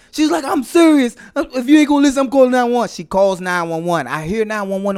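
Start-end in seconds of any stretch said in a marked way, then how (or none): 0.4-2.9 higher, insistent mock panic; imitated panic. Husband imitating wife warning him, higher mock-panicked voice. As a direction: insist urgently in a raised pitch. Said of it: "panicking around the house".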